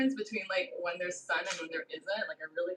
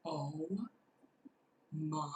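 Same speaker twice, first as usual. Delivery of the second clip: ordinary delivery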